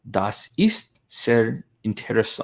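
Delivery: Das ist sehr interess- in a slow, odd German accent; put-on German accent. Shown as a demonstration. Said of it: "I don't know what accent I'm going for there".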